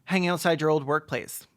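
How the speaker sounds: usual speaking voice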